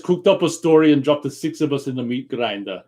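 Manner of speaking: accusing Austrian commando impersonation; thick Austrian accent, movie-star swagger; Arnold Schwarzenegger. Acted. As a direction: confront them like an action-movie hero. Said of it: "this line from Predator I'm really good at".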